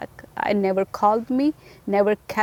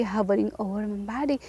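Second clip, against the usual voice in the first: ordinary talk, nothing marked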